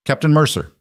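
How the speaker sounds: crisp commanding officer voice; cool, controlled colonel. A narrator's reading. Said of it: "crisp, controlled".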